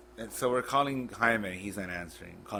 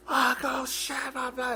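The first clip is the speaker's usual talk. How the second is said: high-pitched mock scream of panic; frantic high yelp trailing into gibberish; retold scream; slower than usual. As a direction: scream it out in garbled shock, far above your voice. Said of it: "it's just a scream".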